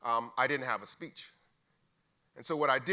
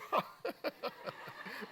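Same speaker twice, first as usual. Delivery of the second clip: airy real laugh; 10 semitones higher, 6 dB quieter than usual. Real, not acted. Speaker laughing at a friend's heckle. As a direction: let the laugh burst out.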